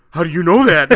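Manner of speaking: loud imitated burst of shock; startled outburst. Coworker caught off guard by friend's question, startled outburst.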